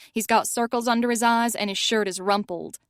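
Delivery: ordinary delivery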